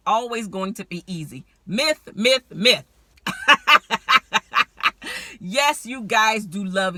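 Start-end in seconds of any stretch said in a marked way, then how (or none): 1.7-2.8 myth, myth, myth hammered louder; punchy repeated declaration; emphatic. Real, not acted. Emphatic myth-busting repetition. 3.2-5.4 real burst of laughter, higher and louder; a loud amused laugh. Real, not acted. Laughs at own point.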